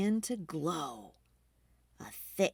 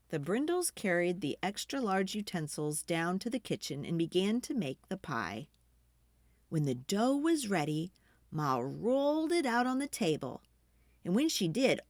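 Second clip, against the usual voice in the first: ordinary delivery, nothing marked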